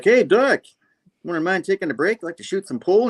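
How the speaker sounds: high, eager acted invitation; chummy henchman. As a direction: pitch it up like a cheery lackey. Bar henchman invites the villain.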